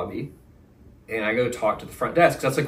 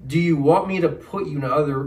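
mocking; 4 semitones higher than usual. Mocking manager's offer.

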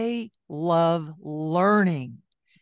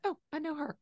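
pleased surprise; 10 semitones higher, 9 dB quieter, faster than usual. Retold or imitated. Recognizing a friend's quote.

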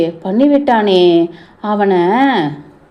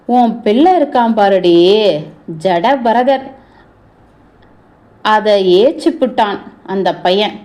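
ordinary talk, nothing marked

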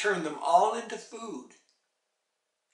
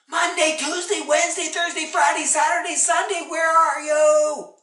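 put-on high, frantic yell for the children; panicked mother's loud holler; 11 semitones higher, 11 dB louder than usual. A narrator's reading. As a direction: shout each name, stretching the final question. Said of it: "And she yelled".

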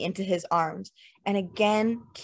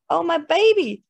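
high, louder alarmed cry; mock shriek; 9 semitones higher, 6 dB louder than usual. Lynette hearing her baby cry in the next room, alarmed cry. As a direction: cry out as if the baby's in danger. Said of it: "Lynette shrieked".

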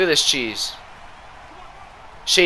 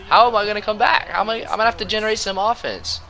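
exasperated outcry; 11 semitones higher, 5 dB louder than usual. Real, not acted. Down fourteen, despairing.

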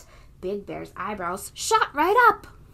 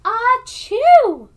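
hammed-up sneeze, much higher and much louder; explosive cartoon sneeze, louder still; big sneeze; 13 semitones higher, 15 dB louder than usual. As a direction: blast the biggest achoo yet. Bear's third sneeze.

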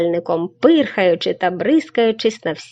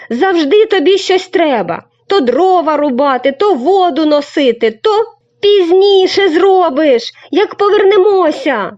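annoyed complaint; 9 semitones higher, 5 dB louder than usual. Acted. Boy scolds crane, annoyed.

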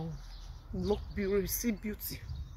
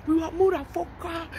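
high mimicked boasting; put-on, high-pitched swagger; mock bragging; 8 semitones higher than usual. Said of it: "you don't need to be bragging and say".

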